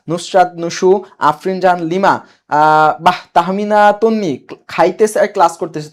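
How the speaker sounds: ordinary delivery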